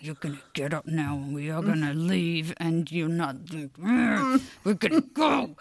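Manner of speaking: low, tight talk through clenched teeth; through gritted teeth. Retold or imitated. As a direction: hiss the words through your teeth. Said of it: "doing the talk through my teeth".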